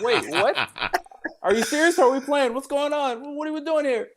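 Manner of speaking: high baffled questions; high incredulous confusion; mock bewilderment. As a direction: ask like totally lost in the game. Bewildered parent.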